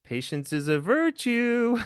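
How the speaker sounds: very high, slow sing-song; drawn-out teasing sing-song; sing-songy. Retold or imitated. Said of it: "They would do the same sing-songy".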